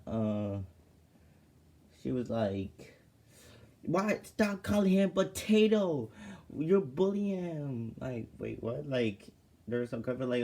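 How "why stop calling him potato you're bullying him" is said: high, loud angry telling-off; ballistic. Retold or imitated. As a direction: snap at them to stop, pitched high and loud. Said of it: "went ballistic".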